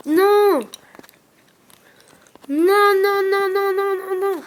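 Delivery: high, loud panicked denial. Real, not acted. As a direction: cry out no as it goes wrong. Panicking as enemy attacks.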